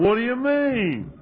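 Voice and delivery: high, loud, drawn-out protest; indignant disbelief. Retold or imitated. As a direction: act out an affronted question, stretched slow and high. Retold indignant reply to laughing friend.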